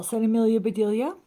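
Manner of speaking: ordinary delivery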